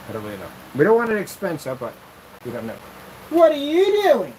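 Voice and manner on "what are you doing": high, loud, slow sing-song call; dog-calling voice. Real, not acted. Calling his dog Taco in a high sing-song voice.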